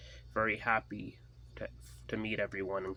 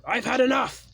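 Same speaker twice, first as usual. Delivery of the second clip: very high, loud shout; furious shout; 10 dB louder than usual. Acted. Tyler exploding before pushing Ethan, furious shout. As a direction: explode with rage. Tyler loses his temper.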